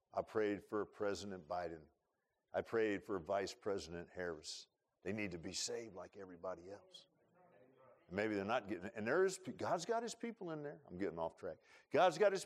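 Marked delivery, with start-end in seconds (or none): none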